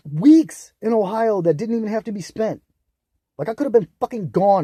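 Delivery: sudden loud high yell of frustration; real furious shout; angry outburst. Real, not acted. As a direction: blow up at the wasted weeks. Venting rage about Ohio.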